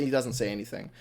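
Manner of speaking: ordinary talk, nothing marked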